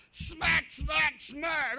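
loud, punchy imitated smacks; shouted mock smack sounds; ruler smacks. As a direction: beat out each ruler hit. Ruler strikes acted out.

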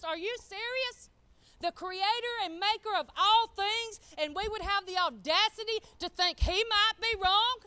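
loud, high-pitched incredulous preaching; full-force preaching. Real, not acted. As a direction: build to a loud, high challenge to the congregation.

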